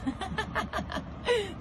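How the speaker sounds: high-pitched real giggle; laughing. Real, not acted. Confessing she drove off with the broomstick, laughing.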